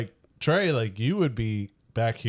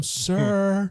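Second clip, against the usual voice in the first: high-pitched mock call; imitated yelling lady; 8 semitones higher than usual. Drive-thru lady calls the customer, mock high yell. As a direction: call out high, without much volume. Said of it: "this lady yelling at him".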